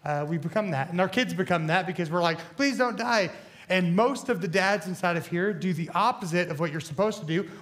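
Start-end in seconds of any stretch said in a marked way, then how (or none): none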